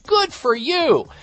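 high, loud and slow "Good for you"; emphatic approval. Retold or imitated. Host cheering the anchor's refusal, high and emphatic. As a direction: cheer her on for refusing the doctor. Host's inner cheer for her.

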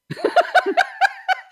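loud high burst of laughter; real laugh at her own joke; big laugh. Real, not acted.

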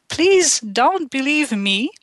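usual speaking voice

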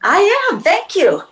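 high, grand diva voice; diva reply. Retold or imitated. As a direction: play the adored star. Acting the flattered star.

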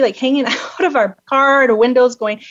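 normal talking voice

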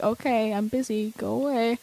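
quietly unimpressed mom voice; dismissive mom. Retold or imitated. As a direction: imitate a busy mom waving off her kid. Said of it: "she kinda had that tone of voice".

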